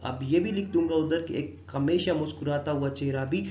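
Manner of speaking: ordinary talk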